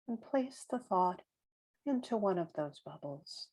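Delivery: calm meditation voice, long silences between phrases; guided meditation. Real, not acted. Guide counting breaths with listeners, calm and spacious meditation voice. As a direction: leave room for each breath, gently.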